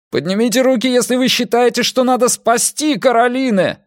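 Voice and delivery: high, pleading yell; frantic high-pitched command; desperate shout. A narrator's reading. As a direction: shout in despair, time running out. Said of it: "Опять прокричал Эрик уже в отчаянии".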